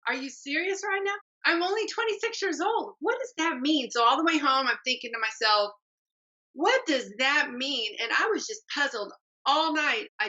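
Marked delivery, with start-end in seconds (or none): 0.1-3.9 higher, disbelieving outburst; a shocked young woman protesting; retold disbelief. Shocked reply to uncle's remark. 6.5-7.9 slow, pondering repeat of What does that mean?; puzzled musing. Retold or imitated. Pondering alone on drive home.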